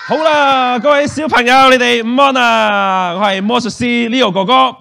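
loud, high showman's welcome; big stage greeting. Real, not acted. Magician greets the children, calling out loud and high.

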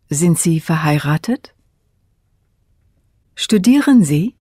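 ordinary talk

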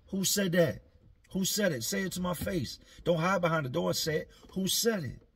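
high, loud angry demand; mock angry challenge. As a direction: challenge them to say it to his face. D demanding who insulted him.

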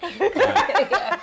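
high laughing "all right". Real, not acted. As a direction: laugh through the words. Laughing after teasing.

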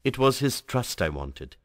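ordinary delivery

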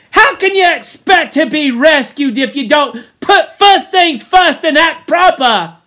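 loud, high-pitched scolding; Piggy's angry tirade. A narrator's reading. Piggy scolds the boys about rescue, loud angry tirade. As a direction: rant at them at the top of your voice. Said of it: "listening to his tirade".

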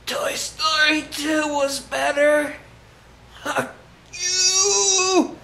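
high, slow hammed-up dying; overacted death scene. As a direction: ham up a dying gasp. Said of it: "that dramatic enough?".

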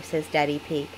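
ordinary delivery

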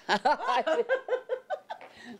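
high burst of bashful laughter; embarrassed laugh. Real, not acted. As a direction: laugh it off, a little shy. Laughing at her many boyfriends.

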